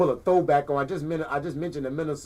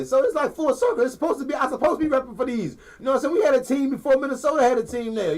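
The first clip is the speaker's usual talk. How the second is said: excited; 7 semitones higher than usual. Real, not acted. Excited about the Lakers' history.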